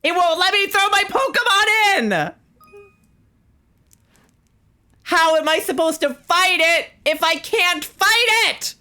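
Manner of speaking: high-pitched exasperated rant; frustrated yelling. Real, not acted. Player who can't throw a Pokemon in, furious. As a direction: yell in helpless frustration, very high.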